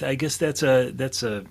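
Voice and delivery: usual speaking voice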